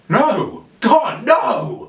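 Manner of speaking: rising, exasperated outcry; emphatic no. Real, not acted. Reviewer shouting down his own sarcastic question. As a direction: shout it down in disbelief.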